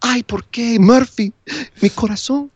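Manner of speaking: high, hammed-up telenovela lament; telenovela drama. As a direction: wail it like a soap opera heroine. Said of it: "loves him some telenovela stuff".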